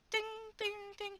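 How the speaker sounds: high chiming ding ding, like a correct-answer bell. Pretend. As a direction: ring it out like a quiz-show bell.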